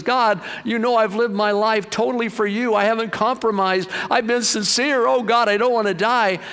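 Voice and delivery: pleading cry. Retold or imitated. Hezekiah crying out to God in his bedroom, pleading. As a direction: beg God not to let him die. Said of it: "starts crying and says".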